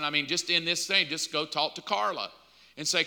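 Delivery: ordinary delivery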